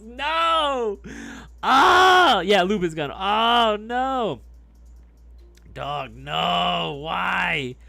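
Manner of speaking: loud, high cry of horror; horrified shout. Real, not acted. Streamer yelling in horror at a viewer's cooking-oil lube.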